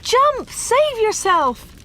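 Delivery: very high, loud, stretched plea; desperate shout. Acted. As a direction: beg him from the street below. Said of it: "they shouted".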